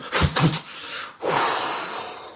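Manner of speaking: sharp puffs, then a long breathy blow; imitated blowing out. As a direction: act out blowing water off your face. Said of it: "throws water in his face, and goes".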